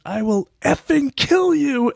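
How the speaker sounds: slow, high mock roar of rage, in a put-on voice; road rage threat. Friend yelling at a driver at a stoplight, in a hammed-up rage. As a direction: act out a mild friend snapping at a stranger. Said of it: "in his rage".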